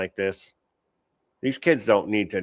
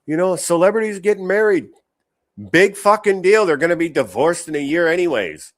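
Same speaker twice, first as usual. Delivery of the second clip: higher, louder mock-gushing celebrity fan; 7 semitones higher, 7 dB louder than usual. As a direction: ham up a starstruck gossip voice. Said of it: "people wanting to be like".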